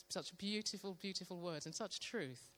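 ordinary talk

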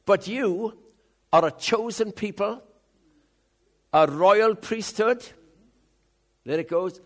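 solemn Bible reading from the pulpit; scripture reading. Acted. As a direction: proclaim the verses like a herald. Reads scripture aloud to congregation.

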